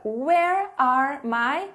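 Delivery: "Where are my" stretched out very slowly with a rising end; slow teacher prompt with a rise; slow dictation. Shown as a demonstration. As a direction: draw out each word for students. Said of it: "pergunta que vocês vão fazer".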